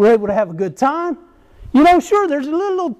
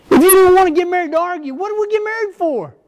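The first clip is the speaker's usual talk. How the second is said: higher, mock indignant self; playing his younger argumentative self, a bit faster; retold argumentative retort; 8 semitones higher than usual. Husband firing back at his wife, in higher mock indignation. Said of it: "That's what I told her".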